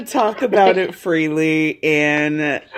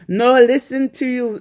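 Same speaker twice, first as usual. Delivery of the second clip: higher voice in a muddled, badly faked German-Jamaican accent; terrible accent, Ariette; 7 semitones higher, 3 dB louder than usual. Shown as a demonstration. Host retries friend's line, higher, terrible put-on accent. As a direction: do a bad German-ish accent, pitched up. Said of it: "That's a terrible accent".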